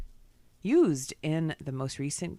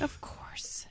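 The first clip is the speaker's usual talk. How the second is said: whispering; 9 dB quieter than usual. Acted. Whispered trivia aside.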